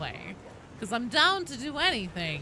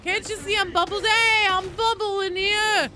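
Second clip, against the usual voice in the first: high, loud mock-outraged yell; shouted protest in the heat of play; indignant shout; 11 semitones higher, 8 dB louder than usual. Real, not acted. Calling out mid-fight, indignant.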